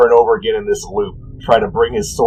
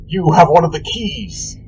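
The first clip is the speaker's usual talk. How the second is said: slow, solemn warrior vow ending in a shout; a holy knight promising to strike down the god; vowing paladin; 5 semitones higher, slower than usual. Acted. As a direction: pronounce his doom slowly, rising to a battle cry. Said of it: "and says".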